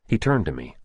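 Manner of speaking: plain reading voice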